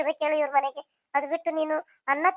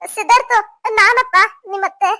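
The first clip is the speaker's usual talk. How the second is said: loud, raised call into the house; 3 semitones higher, 7 dB louder than usual. Acted. Aunt announces herself to nephew indoors, calling out. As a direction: announce yourself loudly from the door. Aunt calls in at open door.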